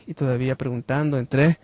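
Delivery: ordinary talk, nothing marked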